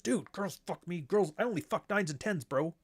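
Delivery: mock bro boast; pickup artist bro. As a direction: swagger it out like a smug pickup artist. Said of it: "if I was, like, a pickup artist".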